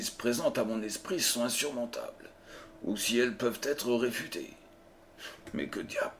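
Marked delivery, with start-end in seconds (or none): 5.5-6.1 a low, breathy startled oath under the breath; startled exclamation. A narrator's reading. Holmes startled as the door flies open, hushed oath. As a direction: caught off guard by the door bursting open. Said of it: "Cette exclamation avait été arrachée".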